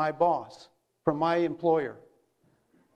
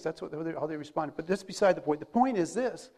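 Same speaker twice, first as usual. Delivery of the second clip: ordinary delivery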